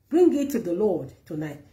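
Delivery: ordinary delivery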